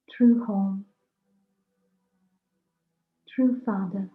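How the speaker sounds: ordinary talk, nothing marked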